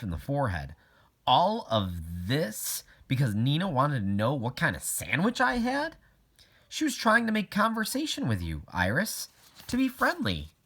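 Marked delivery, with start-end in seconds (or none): 1.3-10.5 clearly higher principal's voice; a patient principal smoothing things over; principal explaining. A narrator's reading. Miss Shelton to Iris, higher patient principal voice.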